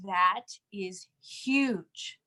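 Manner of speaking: very slow, weighty statement; emphatic. Real, not acted. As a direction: lean hard on each word. Stressing a point after reading.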